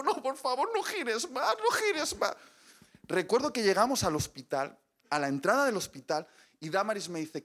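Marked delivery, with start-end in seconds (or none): 0.0-2.3 high, rising desperate plea; pained pleading. Retold or imitated. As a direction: beg her to stop turning. Begging wife in car. (súplica de dolor cada vez más aguda)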